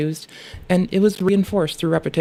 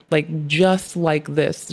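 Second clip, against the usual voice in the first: very slow drawn out; much slower than usual. Shown as a demonstration.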